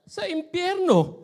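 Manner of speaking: put-on high, loud, slow child's reply; cheeky child. Child tells the tanod where he lives, high and drawn out. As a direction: drag it out like a spooky little kid. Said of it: "sabi nung bata".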